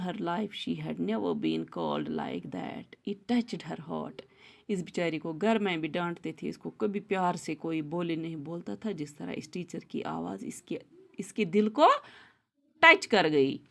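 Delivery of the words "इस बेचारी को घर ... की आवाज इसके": ordinary delivery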